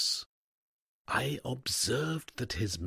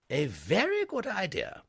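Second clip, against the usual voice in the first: higher, firm lady's voice; firm headmistress, pitched up; decided headmistress; 12 semitones higher, 4 dB louder than usual. A narrator's reading. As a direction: settle it with a headmistress's decision. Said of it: "said Miss Tomlinson decidedly".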